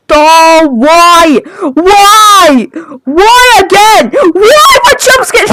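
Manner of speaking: rising wailing why after a scare; scared horror game streamer. Real, not acted. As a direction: cry out in real fright.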